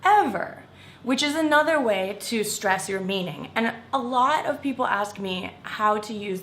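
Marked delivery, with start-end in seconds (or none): 0.0-0.6 "ever" high and loud; stressed ever. Shown as a demonstration. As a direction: pop "ever" up high and strong. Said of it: "really emphasizes the word".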